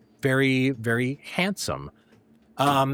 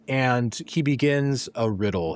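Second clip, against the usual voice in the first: ordinary talk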